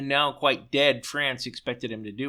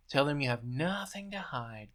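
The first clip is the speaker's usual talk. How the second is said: buzzing in his ear; 7 dB quieter than usual. A narrator's reading. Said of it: "Goebbels buzzed inside his ear".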